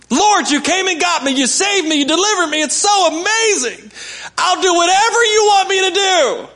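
high, bright gushing praise; ecstatic gratitude. Pretend. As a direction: overflow with thankful joy. Grateful new worker to the Lord.

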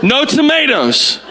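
exasperated dad laying down the order; elevated voice. Acted. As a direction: insist firmly, stretching each word. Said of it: "we start elevating our voice".

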